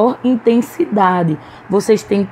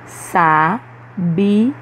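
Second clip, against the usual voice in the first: "Sa-bi-a" slowly split into three, last syllable rising. Shown as a demonstration. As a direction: separate each syllable of "Sa-bi-a", lifting the final a. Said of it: "pronunciando cada uma de suas sílabas". ("Sa-bi-a" sílaba por sílaba, subindo no último a)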